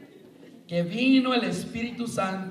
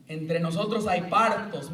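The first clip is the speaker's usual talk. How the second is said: ordinary delivery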